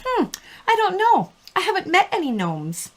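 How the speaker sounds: high, dry mock-serious reply; deadpan friend. Friend answering the gnome question, high deadpan. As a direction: play it totally straight and innocent. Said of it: "And she goes".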